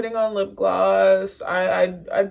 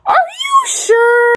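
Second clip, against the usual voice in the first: very high, drawn-out loud cry; incredulous shriek; 11 dB louder, much slower than usual. Acted. Told the pining is embarrassing, shrieked incredulous question. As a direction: screech the question slowly in mock disbelief.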